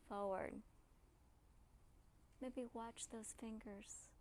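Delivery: soft, soothing relaxation voice; quiet, gentle cues with long pauses; guided meditation. Real, not acted. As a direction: ease them into letting tension go.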